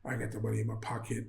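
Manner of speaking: low, hushed, dejected self-talk; subdued worry. Retold or imitated. Stranded traveller up in the hotel, subdued worry. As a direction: mutter it low and soft, stuck with no money.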